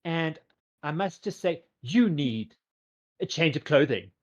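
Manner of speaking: high, theatrical fashion diva; gushing fashion boutique owner. Acted. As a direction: gush grandly, stretching the key words. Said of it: "walks up to you and goes".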